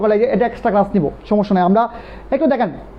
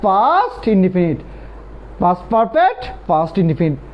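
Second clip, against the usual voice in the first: stretched out very slowly and lower; slow drill; 6 semitones lower, much slower than usual. Shown as a demonstration. Teacher dictating the rule, slow and drawn out. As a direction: drag each syllable out. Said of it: "before-এর পরে".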